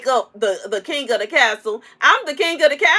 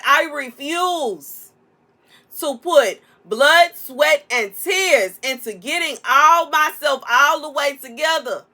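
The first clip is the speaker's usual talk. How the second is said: slow emphatic; slower than usual. Real, not acted. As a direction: drag every word out for emphasis. Emphatic refusal, slowed.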